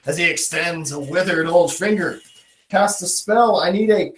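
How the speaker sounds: ordinary talk, nothing marked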